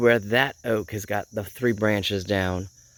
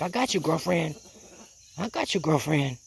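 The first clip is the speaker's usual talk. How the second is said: lightly voiced bird, a bit higher; protective rooster voice; 5 semitones higher, 3 dB quieter than usual. Owner voices rooster looking after hen, lightly reassuring. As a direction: play a rooster reassuring his girl. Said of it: "He's doing his job".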